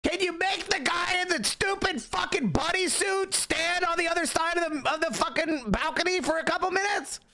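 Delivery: high-pitched, outraged yell; real, worked-up rant pitched high; exasperated shouting. Real, not acted. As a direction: yell it in disbelief at the absurdity. Irate reaction to a clip.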